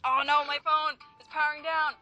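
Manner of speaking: usual speaking voice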